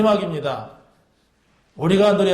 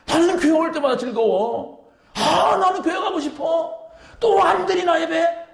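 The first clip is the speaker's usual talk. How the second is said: higher, bright mock churchgoer; eager churchgoer; 9 semitones higher than usual. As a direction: play the overjoyed believer. (신나서 들뜬 높은 목소리로 흉내)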